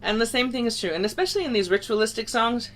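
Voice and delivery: ordinary talk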